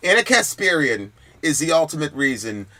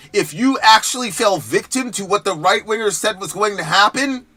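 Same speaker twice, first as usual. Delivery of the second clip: ordinary delivery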